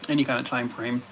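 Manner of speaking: ordinary delivery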